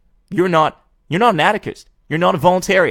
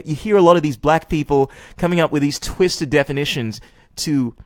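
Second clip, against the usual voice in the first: ordinary talk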